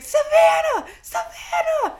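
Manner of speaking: high, loud frantic yelling; screaming. Retold or imitated. Brother yelling up at sister about the UFO, screaming. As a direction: yell it like a panicked alarm. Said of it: "And he's screaming".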